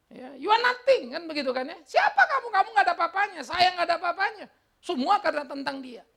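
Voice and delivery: high, loud, emphatic rebuke from the pulpit; full-force preaching. Real, not acted. Preacher telling himself and congregation they are nothing, full-force preaching.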